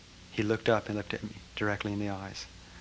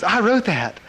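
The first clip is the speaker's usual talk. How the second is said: high, louder delighted declaration; gleeful claim; 11 semitones higher, 8 dB louder, slower than usual. Retold or imitated. Master asking him again, gleefully pleased. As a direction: savor it with glee.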